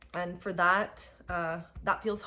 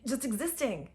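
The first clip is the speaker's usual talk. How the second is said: ordinary talk, nothing marked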